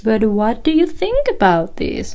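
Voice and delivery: mock deferential asking, rising in pitch; hesitant, approval-seeking question; tentative question. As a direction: imitate someone seeking validation instead of being firm. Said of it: "More of a question".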